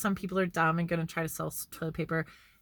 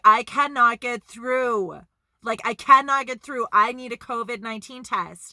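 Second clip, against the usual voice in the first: retold desperation; 4 semitones higher, 7 dB louder than usual. Friend who cannot reach 811 begging for a test, frantic. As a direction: stretch it out, at the end of his rope. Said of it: "He's like".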